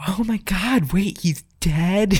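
high, slow, overacted shock; faked dead-prank reaction, high and slow; fake prank reaction. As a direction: ham up a fake discovery, voice up high and drawn out. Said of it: "fabricated reactions".

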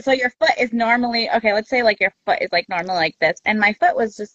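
ordinary delivery

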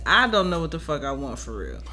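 ordinary talk, nothing marked

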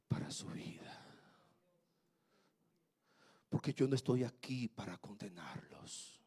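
soft, near-crying whispered plea; whispered plea to the young people, close to tears; pained whisper. Retold or imitated. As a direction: plead gently, almost in tears. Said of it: "con dolor y casi llorando le digo".

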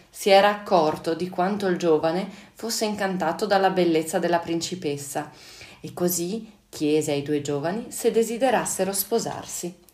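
plain reading voice

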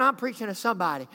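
ordinary talk, nothing marked

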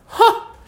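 high, loud squeal of laughter; single shrieked laugh; little scream of laughter. A narrator's reading. Alice laughs at the news, a little scream. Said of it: "a little scream of laughter".

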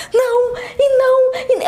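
high, sobbing protest in panic; panicked crying no. Retold or imitated. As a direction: cry out no as if terrified. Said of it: "Ela me empurrou e falou assim".

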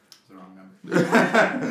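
slow, high, laughing phone voice; mock phone voice. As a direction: announce it like a prank call. Hanging up the lobster phone.

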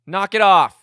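loud stern yell; shouted command. Retold or imitated. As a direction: bark it at the kids. Teacher yelling at fighting kids.

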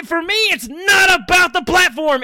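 loud, high-pitched insistence; emphatic shout. Real, not acted. Co-host insisting it's not about the platform, emphatic shout. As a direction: raise the voice sharply to make the point. Emphatic declaration breaking into the banter.

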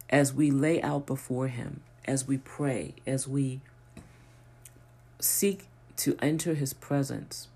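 ordinary talk

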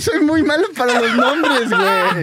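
sheepish laughing confession. Real, not acted. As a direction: laugh at yourself while admitting it. Laughing at own failure.

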